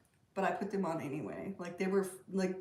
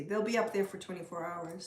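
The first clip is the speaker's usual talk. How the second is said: ordinary talk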